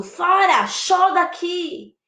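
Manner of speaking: high call to drive someone off; shooing away. Shown as a demonstration. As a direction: shoo them out, stretching the end.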